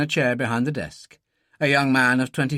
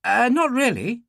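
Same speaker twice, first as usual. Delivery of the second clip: slightly hurried, higher-pitched reply; 11 semitones higher than usual. A narrator's reading. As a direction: answer a little hastily. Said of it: "Dillon said hurriedly".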